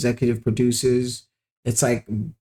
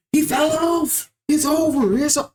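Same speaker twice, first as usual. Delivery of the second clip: mock hysteria; 13 semitones higher than usual. Mimicking fans declaring a rapper finished, mock hysteria. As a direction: imitate overdramatic fans, pitch way up.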